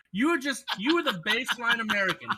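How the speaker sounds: laughing through the words; giggling while teasing him; cracking up mid-sentence. Real, not acted.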